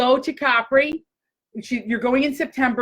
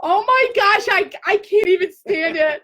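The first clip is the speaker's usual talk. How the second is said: much higher, giddy and breathless with delight; thrilled squeal; 8 semitones higher than usual. Real, not acted. Thrilled by gift of sandals.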